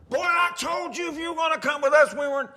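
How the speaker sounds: put-on furious father shouting at his boy; angry dad yelling. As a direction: bark at the kid like an angry dad.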